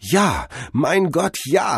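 high, drawn-out cry of revelation; dazzled cry. A narrator's reading. Cordell blinded by sudden revelation, awed high cry. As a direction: cry out as the truth dawns. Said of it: "rief Cordell, geblendet vom gleißenden Licht".

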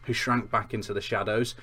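plain reading voice

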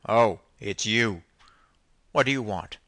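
stern and put out at being disturbed; a curt, forceful captain. A narrator's reading. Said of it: "said in a pretty stern tone".

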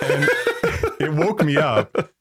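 laughing through the words. Real, not acted. Telling friends about his dream, laughing. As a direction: giggle while retelling. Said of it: "laughing his ass off".

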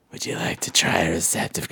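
low hushed whisper. Retold or imitated. Booth guy offering condoms again, whispered. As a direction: murmur the offer into an ear.

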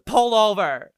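loud, high imitated order; mock-urgent shout to the driver; shouted demand. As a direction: yell it like an upset passenger. Drunk rider demands a stop.

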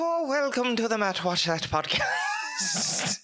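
hammed-up Irish accent, slow; overdone Irish accent; bad Irish accent. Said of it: "with a really bad Irish accent".

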